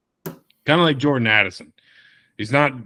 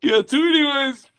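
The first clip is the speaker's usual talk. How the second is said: much higher, goofy put-on voice; high silly voice; 14 semitones higher than usual. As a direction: squeak it out like a cartoon.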